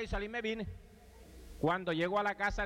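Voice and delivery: ordinary talk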